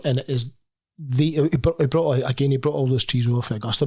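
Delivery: usual speaking voice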